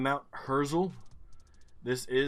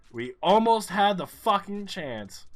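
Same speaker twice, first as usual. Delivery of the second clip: higher, louder, slow angry words; frustrated outburst; 8 semitones higher, 8 dB louder, slower than usual. Real, not acted. Gamer venting at the map about the missed chance, exasperated. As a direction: drag it out in exasperation.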